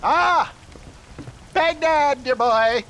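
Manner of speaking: high, loud theatrical exclamation; grand flourish. Acted. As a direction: announce it like a showman.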